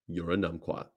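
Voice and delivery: low soft deadpan; quiet take. Shown as a demonstration. Retaking the insult for camera, low and soft. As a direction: say it low and under the breath. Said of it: "How's that work?".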